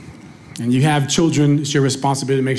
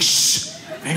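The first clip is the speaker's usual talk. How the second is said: acted shushing, half under the breath; urgent hush; 12 semitones higher, 4 dB quieter than usual. Dad shushing his daughter at the mall, hushed. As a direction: hush a kid in public.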